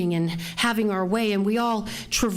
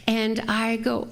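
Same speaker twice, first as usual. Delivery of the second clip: ordinary talk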